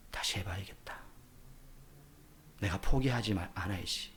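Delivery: very soft, low murmur to oneself; barely voiced, low private vow; quiet resolve. Preacher voices a discouraged person resolving to try again, quiet resolve.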